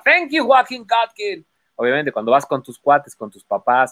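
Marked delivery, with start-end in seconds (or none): 0.1-1.4 high, drawn-out stage thanks; David Copperfield. Retold or imitated. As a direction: imitate a star magician calling to one spectator. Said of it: "volteaba y decía". (agradecimiento agudo y teatral desde el escenario)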